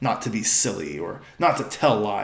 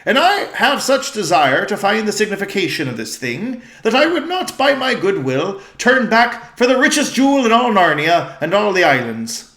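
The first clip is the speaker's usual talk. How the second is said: higher, louder eager king; regal old-fashioned speech; 7 semitones higher, 7 dB louder than usual. Acted. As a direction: vow like a determined king. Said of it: "their voice has changed".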